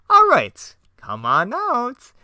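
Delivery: high, loud, slow call; Joker voice. Acted. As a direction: summon your gang with glee. Joker calls villains.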